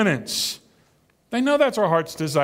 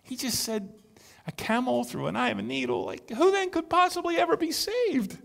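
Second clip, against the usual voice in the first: high, incredulous and a little hushed; mock astonishment from the crowd; bewildered listener in the crowd; amazed crowd; 7 semitones higher, 4 dB quieter than usual. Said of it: "can't you see yourself in the crowd saying".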